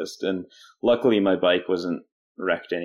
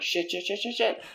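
panicked swearing; 13 semitones higher, 5 dB quieter than usual. Retold or imitated. Panicking as bus turns in.

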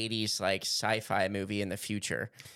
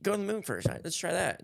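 mocking Elon; 8 semitones higher, faster than usual. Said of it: "He has all this promise".

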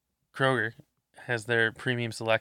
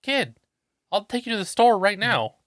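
excited shout; 9 semitones higher, 8 dB louder than usual. Retold or imitated. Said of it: "I'm like".

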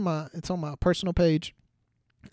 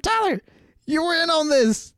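much higher, louder incredulous protest; exasperated call-out; 13 semitones higher, 6 dB louder than usual. Retold or imitated. Narrator scolding buddy Tyler for faking worry, loud and high. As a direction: throw his name back at him in disbelief.

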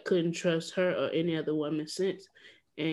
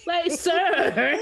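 exaggerated cartoon intonation; 10 semitones higher, 6 dB louder than usual. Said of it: "Why did you speak like that".

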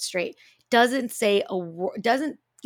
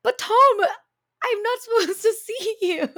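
laughing disbelief; 13 semitones higher, 4 dB louder than usual. Retold or imitated. Realizing wrong patient, laughing.

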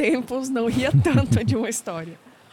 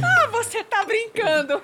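higher, slow, laughing disbelief; 12 semitones higher than usual. Real, not acted. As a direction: laugh while refusing to believe it. Reacts to the funny story. (rindo sem acreditar)

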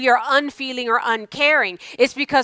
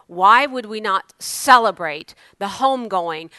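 usual speaking voice

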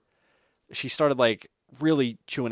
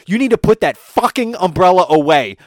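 loud, high scolding; imitating a furious fan, louder; angry demand; 7 semitones higher, 12 dB louder than usual. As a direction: storm down and order her. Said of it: "And she's like".

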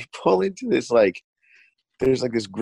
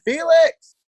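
high, loud call across the store; 5 dB louder than usual. Retold or imitated. Clerk calls for her coworker, loud high shout. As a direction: yell for the coworker, pitched way up.

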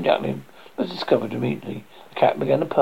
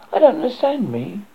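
plaintive duckling; 7 semitones higher than usual. Acted. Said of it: "said the duckling".

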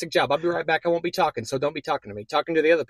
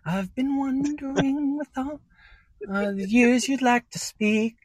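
high, slow crooning; [name] impression; 6 semitones higher, slower than usual. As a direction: croon it like a ballad singer. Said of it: "I'm [name]".

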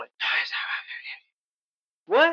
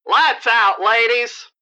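calling out; 5 dB louder than usual. Acted. Guard calling out.

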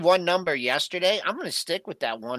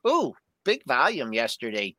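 ordinary talk

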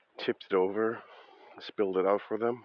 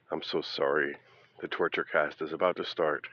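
ordinary talk

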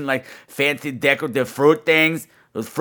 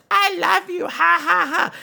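much higher squeaky toy voice with a giggle; talking teddy bear. Retold or imitated. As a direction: imitate a pull-string teddy bear. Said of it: "the little teddy bear goes".

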